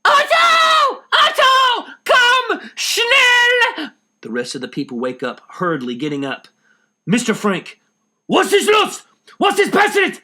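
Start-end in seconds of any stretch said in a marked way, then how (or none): 0.0-3.9 piercing high scream, much higher and louder. Acted. Missus Frank waking to a thief, screaming for Otto. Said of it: "Missus Frank screaming". 7.1-10.2 high, loud frightened calls; alarmed shout. Acted. Woken in the night, shouting alarmed questions.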